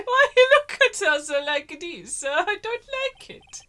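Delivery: high, loud laughing complaint; mock outrage. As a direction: squeal in fake horror then laugh. Mock-outraged at character's face.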